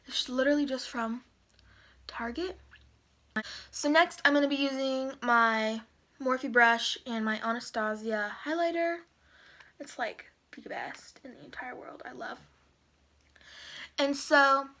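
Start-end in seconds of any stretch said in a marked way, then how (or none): 2.1-2.6 soft whispered aside; whispering. Real, not acted. Whispered aside during makeup. 9.8-12.4 hushed whisper, almost breath. Real, not acted. Whispered aside during makeup.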